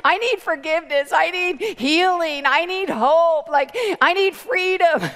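loud, slow desperate plea; acted tearful begging; imitated sobbing plea. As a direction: plead with each need drawn out, mimicking a girl in tears. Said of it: "she's, like, sobbing".

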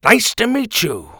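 high, loud friendly greeting; hearty how-do-you-do; cheery dad. A narrator's reading. Father shaking the cat's nose, cheery.

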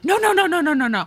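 mock desperate no's; eager protest. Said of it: "she's like".

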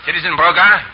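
high, loud official arrest call. Acted. Chauvelin arresting the innkeeper, loud and commanding. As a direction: seize him in the Republic's name.

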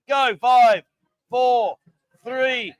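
countdown call. Real, not acted. Counting down a drinking challenge.

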